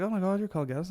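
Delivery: high, excited fan recognising him; excited fan girl. Retold or imitated. Fan spotting him in town, high excited voice. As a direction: squeal his name like a starstruck fan. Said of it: "She's like".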